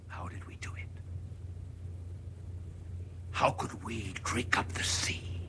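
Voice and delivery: low, fearful whispered questions; awed whisper. Acted. Madman asking the crowd how they did it, whispered. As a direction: whisper it as if dreading the answer.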